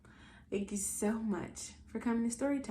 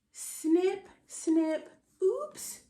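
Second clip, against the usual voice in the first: higher, louder snip snip, squeaky oops; playful sound words; 5 semitones higher, 5 dB louder, much slower than usual. Acted. Auntie voicing the book's sounds, high and playful. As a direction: play the sounds for the kids.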